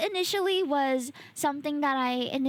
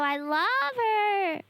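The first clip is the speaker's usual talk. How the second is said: slow, teary gushing; half-cry it out of affection; mock sob; 7 semitones higher, much slower than usual. Real, not acted. Gushing about friend.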